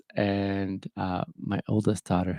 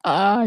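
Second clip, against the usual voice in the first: choked-up gasp; 12 semitones higher, 6 dB louder than usual. Retold or imitated. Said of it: "where you're like".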